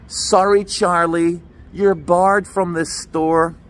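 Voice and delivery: lightly voiced barista, slow and drawn out; barred-customer announcement. Barista barring a customer before the whole queue, slow mock announcement. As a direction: spell the refusal out slowly to a customer. Barista publicly bars him from store.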